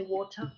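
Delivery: water with an open uh ending; water the British way, R dropped; British water, no final R. Shown as a demonstration. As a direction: leave the R off water. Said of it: "In British you say".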